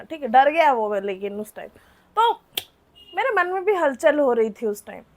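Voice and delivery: usual speaking voice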